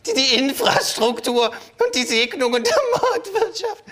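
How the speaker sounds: slow words squeezed out through wild, high laughter; can barely speak for laughing; hysterical laughing fit. Acted. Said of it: "Ein hysterischer Lachkrampf".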